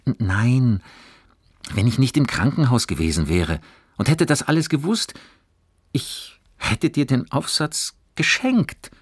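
put-on high, lively boy's voice; eager reassuring boy. A narrator's reading. Johannes would have given away the essay, eagerly reassuring. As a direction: insist eagerly that it's no trouble.

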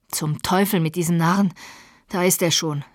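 usual speaking voice

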